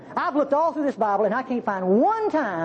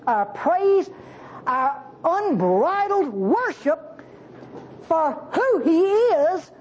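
slow, rising, higher praise build; full-force preaching; 6 semitones higher, slower than usual. Acted. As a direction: climb each word as you proclaim God's worth.

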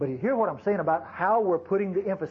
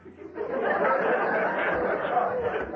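breathy high-pitched laugh; high airy laugh. Real, not acted. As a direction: laugh high and airy at your own joke. Laughing at his own mock insult.

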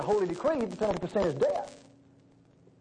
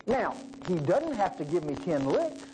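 ordinary talk

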